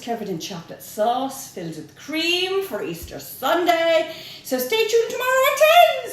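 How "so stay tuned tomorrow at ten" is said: higher, louder call to tune in; host urges viewers to tune in tomorrow, higher and louder; excited sign-off. Real, not acted. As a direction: sell tomorrow's show with a big lift.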